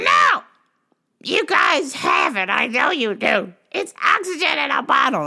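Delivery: hammed-up shrill insistence; mock shouting old lady. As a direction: yell it like the stubborn caller.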